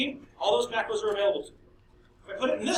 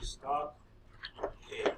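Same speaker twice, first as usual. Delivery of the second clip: slow, quieter word by word; slow dictation; 6 semitones lower, 13 dB quieter, slower than usual. Shown as a demonstration. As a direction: spell it out slowly while typing. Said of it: "I'm gonna give it a name".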